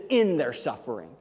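"in" hit hard, then a falling close; stress on in. Shown as a demonstration. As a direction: lean on the word "in", not on "if". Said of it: "Doesn't say if they have some suffering".

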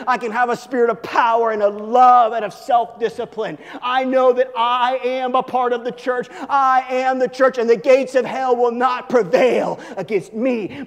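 loud, rising preacher's proclamation; preaching at full force. Acted. Pastor thundering God's promises at the congregation, full preaching force. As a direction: bring the congregation to its feet.

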